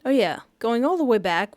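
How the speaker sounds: ordinary talk, nothing marked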